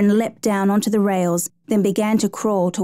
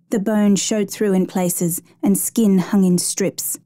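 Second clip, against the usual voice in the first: plain reading voice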